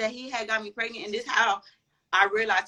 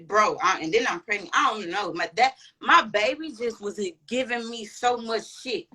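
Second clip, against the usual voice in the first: usual speaking voice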